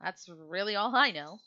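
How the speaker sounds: a touch high, mumbling through food; mild chewing mumble of a distracted girl; lightly voiced girl mid-bite; Lilith with her mouth full. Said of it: "And Lilith goes".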